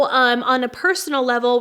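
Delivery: ordinary talk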